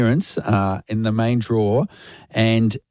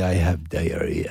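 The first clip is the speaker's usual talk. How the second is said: put-on slow, softer French-accented line; romantic French accent; 5 semitones lower, 4 dB quieter, slower than usual. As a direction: croon it like a French lover. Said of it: "it's so romantic, the language".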